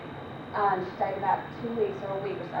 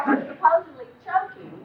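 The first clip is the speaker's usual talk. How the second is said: usual speaking voice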